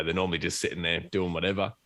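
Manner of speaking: ordinary talk, nothing marked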